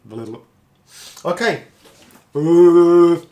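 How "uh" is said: loud sustained vocal warm-up; cheek warm-up noise. Real, not acted. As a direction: hold a loud buzzing warm-up sound. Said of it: "I'm warming up my cheeks".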